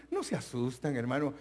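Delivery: ordinary talk, nothing marked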